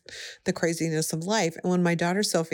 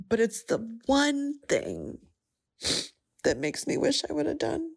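tearful; 7 semitones higher than usual. Real, not acted. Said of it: "this is the part I'm gonna cry".

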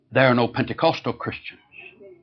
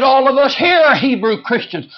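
indignant lady; 13 semitones higher, 8 dB louder than usual. Retold or imitated. Lady protests loudly.

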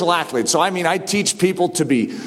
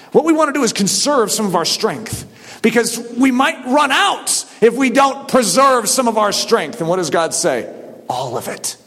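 ordinary delivery

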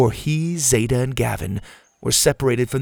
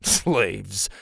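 scoffing. Acted. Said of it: "Ash scoffed".

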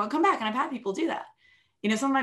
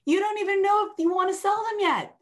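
pitched high and pushed louder, insisting; emphatic retort; 11 semitones higher, 4 dB louder than usual. Retold or imitated. Coach correcting a client who wants to sell, raised and emphatic. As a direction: set the client straight firmly. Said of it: "I said".

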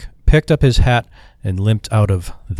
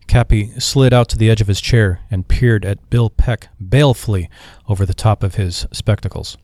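ordinary delivery, nothing marked

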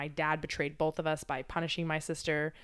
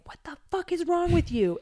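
much higher, louder, outraged shout; hammed-up outburst aimed at a partner; mock angry yell; 12 semitones higher, 6 dB louder than usual. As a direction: snap at your partner out of nowhere. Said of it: "I'm like".

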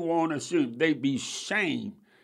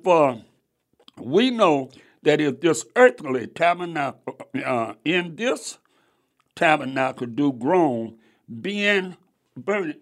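scripture reading; 5 dB louder than usual. Acted. Rereads the verse.

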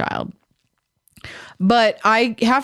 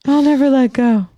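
overacted Titanic line; 4 semitones higher, 4 dB louder than usual. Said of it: "It's like the Titanic".

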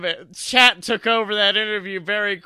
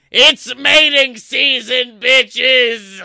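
higher, loud, slow battle cry; triumphant shout; 4 semitones higher, 8 dB louder, much slower than usual. Acted. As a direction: bellow it out to the whole ocean. Sea god ends his proclamation.

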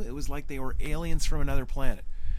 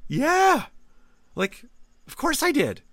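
yeah pitched way up, of course I did, matter-of-fact; 11 semitones higher, slower than usual. Real, not acted. Answering friends who doubt him, a high, breezy of course. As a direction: shrug it off as obvious.

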